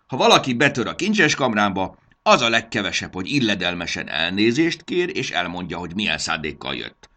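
loud start, settling as it goes; lecturing. A narrator's reading. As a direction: scold intruders about proper manners. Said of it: "magyarázta felemelt mutatóujjal". (kioktató, hangosan induló magyarázat)

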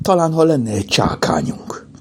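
a big sigh leading into the words. A narrator's reading. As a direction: sigh out a tentative idea. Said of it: "Lupi nagyot sóhajtott".

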